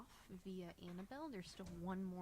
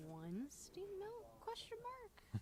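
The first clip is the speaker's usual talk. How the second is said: quizzical; 12 semitones higher, much slower than usual. Acted. Reading a card with a question.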